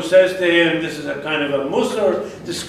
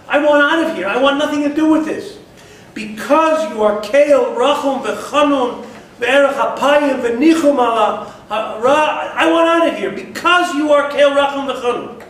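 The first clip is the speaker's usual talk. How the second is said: Yonah's protest; 6 semitones higher than usual. Acted. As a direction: push the complaint up high and hard. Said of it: "and he says".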